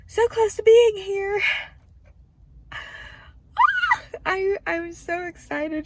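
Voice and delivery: overwhelmed joy. Real, not acted. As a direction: let the joy break through, near tears. Overwhelmed joy, near tears.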